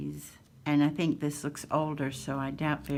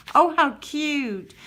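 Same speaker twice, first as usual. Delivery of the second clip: a slow, much higher, louder gush of delight; 10 semitones higher, 11 dB louder, slower than usual. Real, not acted. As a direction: coo over the cute picture. Gushing over a cute illustration.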